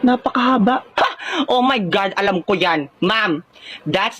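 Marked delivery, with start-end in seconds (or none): none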